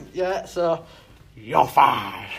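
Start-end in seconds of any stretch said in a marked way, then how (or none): 1.4-2.3 Lord Sugar. Acted. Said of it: "I'm like Lord Sugar".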